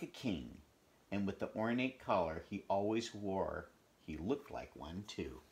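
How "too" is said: plain reading voice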